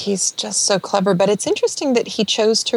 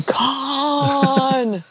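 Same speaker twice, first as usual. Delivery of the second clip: higher, hammed-up shouted name; mock movie scream; 9 semitones higher than usual. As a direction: yell it like an action movie. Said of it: "nobody screaming".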